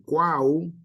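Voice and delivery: cuau drawn out slowly as a single glide; one-syllable triphthong. Shown as a demonstration. Teacher isolates the first syllable for students, cuau as one glide. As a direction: stretch cuau into one syllable, three vowels together. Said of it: "porque en la sílaba".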